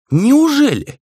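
exaggerated sarcastic disbelief, pitched right up. A narrator's reading. Rei mocking Grizzly's insight, sarcastic. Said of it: "саркастически усмехнулся Рэй".

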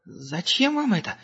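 high, slow, scared question. A narrator's reading. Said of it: "ещё больше испугался техник".

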